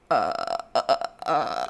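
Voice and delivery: low, dopey imitated "uh" noises; mouth-breather grunts. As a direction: mimic slack-jawed onlookers, short dull grunts. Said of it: "mouth breathing like".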